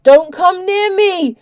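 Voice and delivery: high, loud, drawn-out terrified shriek; frantic screamed warning. A narrator's reading. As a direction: shriek to keep someone off. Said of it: "shrieked the vampire".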